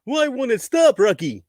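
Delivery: high goofy cartoon moose voice; Bullwinkle voice. Bullwinkle impression.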